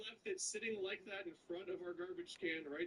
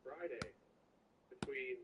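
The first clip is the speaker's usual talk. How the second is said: automated voice; 4 semitones lower than usual. Retold or imitated. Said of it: "automated voice thing".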